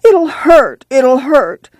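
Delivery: put-on high, fearful cry of a girl; frightened plea. Scared girl pleading to Ma before the piercing. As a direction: beg in fear before the needle.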